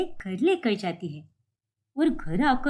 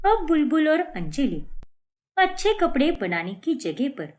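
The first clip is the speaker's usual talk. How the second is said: normal talking voice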